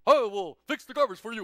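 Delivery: loud, booming statesman impression; President Obama. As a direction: boom it out as a helpful dignitary. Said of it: "the president goes".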